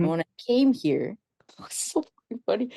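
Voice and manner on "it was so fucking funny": breathless whisper through a laugh; laughing under her breath. Real, not acted. Laughing aside about arriving.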